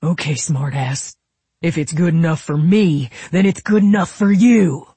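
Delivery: heated, bullying insistence; angry insistence. A narrator's reading. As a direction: start contained, swell to a loud finish. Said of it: "Royal insisted".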